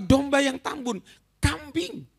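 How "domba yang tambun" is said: ordinary delivery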